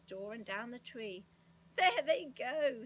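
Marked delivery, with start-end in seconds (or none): 1.8-2.8 loud, drawn-out gleeful cry; delighted. A narrator's reading. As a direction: crow it out slow, loud and high. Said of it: "said Moonface in delight".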